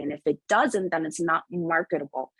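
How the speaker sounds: ordinary talk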